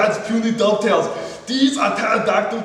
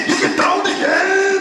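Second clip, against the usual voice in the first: higher, half-laughing shout of get down; mock battle shout; 10 semitones higher than usual. Woodworker psyching himself up at the saw, hammed-up shout. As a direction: hype yourself up like a war cry. Hyping the saw cut again.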